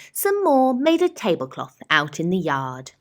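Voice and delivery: usual speaking voice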